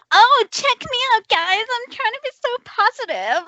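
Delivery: put-on high, gushing American accent; hammed-up American false cheer; fake-positive American accent. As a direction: gush like an over-positive American. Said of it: "I always do an American accent when I'm doing the false positivity thing".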